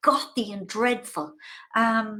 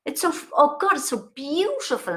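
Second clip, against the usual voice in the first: ordinary delivery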